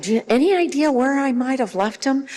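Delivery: high, puzzled appeal for help; asking a child. Shown as a demonstration. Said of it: "by asking a child".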